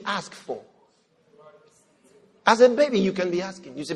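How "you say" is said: ordinary talk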